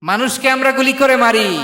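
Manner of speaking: high, loud preaching cry; outraged repeat. Real, not acted. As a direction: cry out "গুলি করে মারি" in indignation.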